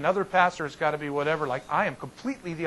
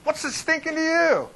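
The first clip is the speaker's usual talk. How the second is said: higher, snappy fisherman comeback; testy fisherman; 12 semitones higher, 4 dB louder than usual. Acted. Tired disciples answering the stranger on the shore, testy and irritated. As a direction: fling it back like a grumpy fisherman. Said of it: "No, they were like".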